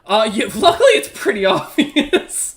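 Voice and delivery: breaking into laughter; amused. Real, not acted. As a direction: laugh your way through the answer. Game master amused by roll.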